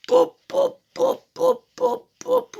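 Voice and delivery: high popping gunshot noises; mock gunshot pops. Friend teasing about his gun, high popping sounds. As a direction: pop pop like a gun firing.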